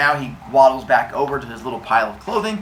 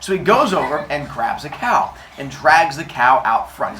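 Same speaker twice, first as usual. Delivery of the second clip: ordinary delivery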